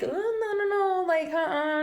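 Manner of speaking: high, frightened retold no's; panicked refusal. Retelling turning down the stranger, high and frightened. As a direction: recoil from the stranger's offer, pitch jumping up high.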